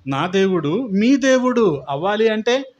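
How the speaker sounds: loud, driving sermon climax; preaching at full force. Acted. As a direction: proclaim it to the church, building in force. (బలంగా, ఉద్వేగంగా ప్రసంగించడం)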